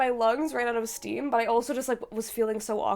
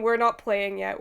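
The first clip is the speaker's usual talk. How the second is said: ordinary talk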